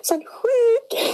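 high burst of laughter, giggling; laughing hard. Real, not acted. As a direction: crack up at the accent. Laughing at friend's accent.

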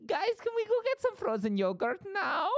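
very high, loud squeaky creature voice; Mort from Madagascar. Acted. Rider asking friends for frozen yogurt afterwards, squeaky pleading. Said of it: "started doing Mort from Madagascar".

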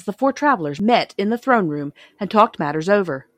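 usual speaking voice

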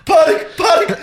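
high, loud mock panic yell; panicked shout. S0 retelling his own reaction to the broken condom, panicked shout. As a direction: shout it in comic alarm. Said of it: "Ich so".

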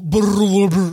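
Brr, brr, brr as long rolled R trills. Shown as a demonstration. Showing friends how the R rolls, trilled Brr. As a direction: trill the R's out loud. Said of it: "too many rolling of the R's".

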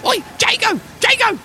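very high goblin voice calling out. Acted. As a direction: yell up high like a little goblin for his brother. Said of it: "he goes".

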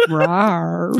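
cartoon lion rawr; lion roar. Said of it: "George Clooney's Wild Animal Park".

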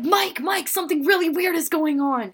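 put-on high, loud, frantic kid voice; a mock-panicked plea for help. The kid runs to his TV brother, panicked and high. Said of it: "He's like".

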